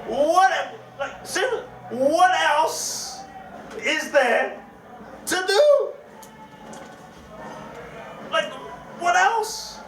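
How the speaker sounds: high, disbelieving what else; incredulous. Real, not acted. Incredulous reaction to friend's words.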